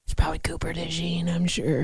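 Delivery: unvoiced whisper; whispered aside. Real, not acted. Trivia guess muttered aside, whispered. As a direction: whisper it to yourself.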